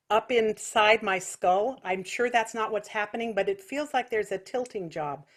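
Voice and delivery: ordinary talk, nothing marked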